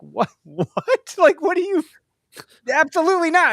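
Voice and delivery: a stunned, disbelieving what; incredulous. Retold or imitated. Host retelling his own shock at the woman's question, incredulous. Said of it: "I'm like".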